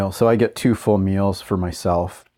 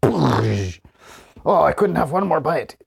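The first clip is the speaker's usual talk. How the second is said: Mister Creosote; 6 semitones higher, much slower than usual. Retold or imitated. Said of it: "Mister Creosote in The Meaning of Life".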